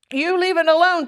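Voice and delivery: high-pitched, scolding order; firm command. A narrator's reading. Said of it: "commanded Fern".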